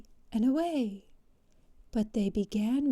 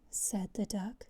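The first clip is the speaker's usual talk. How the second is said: ordinary delivery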